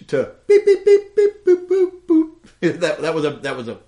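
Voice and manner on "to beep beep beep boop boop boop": high mouthed keypad beeps, loud; push button phone impression. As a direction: beep boop like a phone keypad. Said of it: "dead on impression of a push button phone".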